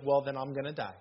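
matter-of-fact wife's line, quoted lightly; deadpan wife. Retold or imitated. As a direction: toss it off as the wife would. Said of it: "That's exactly how she said it".

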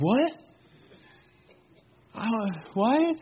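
high, rising disbelief, played for laughs; soft high-pitched comic bewilderment; incredulous husband. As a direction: balk at being volunteered. Husband balking at wife's order.

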